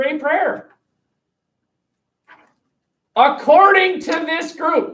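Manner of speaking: slow, high emphatic stress. Real, not acted. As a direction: drive the point home. Teacher stressing forced prayer.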